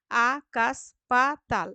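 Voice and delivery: আকাশ পাতাল loud and slow, in four beats; split into syllables. Shown as a demonstration. Teacher counting syllables, slow loud beats. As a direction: space out আ, কাশ, পা, তাল. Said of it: "দল বিশ্লেষণ করে".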